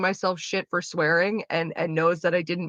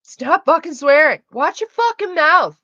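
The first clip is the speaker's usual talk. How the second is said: much higher, louder barked order; mock yelled order; 10 semitones higher, 9 dB louder than usual. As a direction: yell it as a joke command. Reading a chat suggestion aloud.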